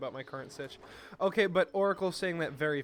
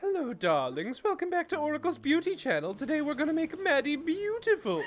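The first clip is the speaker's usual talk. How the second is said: high, campy beauty guru greeting; campy drag queen; 12 semitones higher, 7 dB louder than usual. Pretend. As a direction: gush like a glam beauty host. Hosts mock beauty channel intro.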